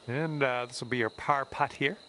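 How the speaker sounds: ordinary talk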